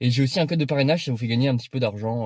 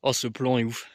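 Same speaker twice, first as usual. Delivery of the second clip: ordinary talk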